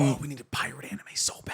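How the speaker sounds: hushed whisper. Real, not acted. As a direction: whisper it yearningly. Whispered aside.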